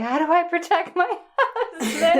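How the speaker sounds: high, laughing mock worry; laughing high-pitched imitation of her own panic. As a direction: fret about the husband while giggling. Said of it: "I'm like".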